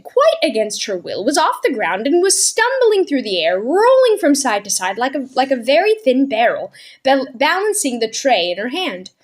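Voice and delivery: ordinary delivery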